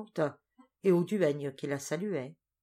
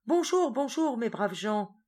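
bright, high, louder joyful greeting; joyful cry; 7 semitones higher, 5 dB louder than usual. A narrator's reading. Teresa greeting the islanders on landing, joyful cry. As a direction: call out your joy at reaching land. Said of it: "Teresa poussa un cri de joie".

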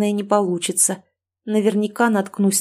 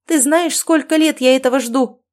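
loud, high indignant outcry; yelling; 6 semitones higher, 4 dB louder than usual. A narrator's reading. Mother yells that she waited years, yelling. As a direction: keep wailing the reproach. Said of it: "снова завопила она".

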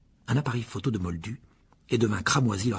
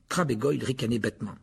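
ordinary delivery

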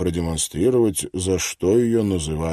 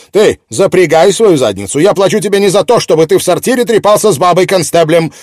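furious editor; 10 semitones higher, 10 dB louder, faster than usual. Acted. Editor bossing Miller.

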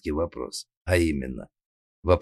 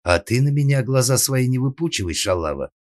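high, loud angry warning; raging mistress, falling on the insult; growing vicious; 7 semitones higher, 5 dB louder than usual. A narrator's reading. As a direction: warn her off furiously. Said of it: "стервенела сеньора Тереза".

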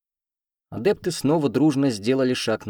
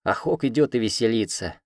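usual speaking voice

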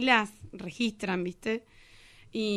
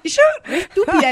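louder, higher self-mocking laugh; laughing at herself; 9 semitones higher, 11 dB louder, faster than usual. Real, not acted. Teacher laughing at her own slip in class. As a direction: laugh at your own blunder. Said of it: "Qué estúpida".

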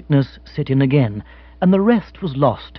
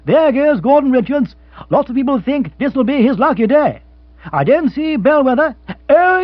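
higher, lively racing announcer; radio race commentator; 10 semitones higher, 4 dB louder than usual. Acted. The radio reads the derby runners to the room. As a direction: call the runners like a broadcaster.

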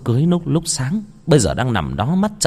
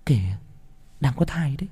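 put-on coaxing young bride; 4 semitones higher, 5 dB quieter than usual. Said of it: "nằm ở bên dưới thân anh ủy mị".

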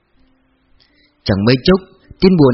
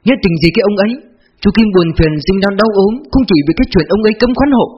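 higher-pitched, aggrieved protest; indignant; 6 semitones higher than usual. A narrator's reading. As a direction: voice a bitter complaint, pitched up. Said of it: "Bà Thường nói giọng bức xúc". (giọng bức xúc, cao lên)

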